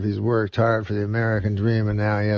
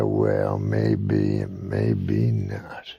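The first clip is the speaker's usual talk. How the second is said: a lightly voiced bigot drawling his taunt; 12 semitones lower, much slower than usual. Redneck taunts the Mexican shopkeeper.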